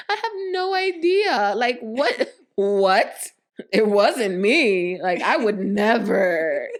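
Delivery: high, loud, drawn-out mock innocence; acting dumb. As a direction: play innocent, stretching it out theatrically. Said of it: "'cause I always acted dumb".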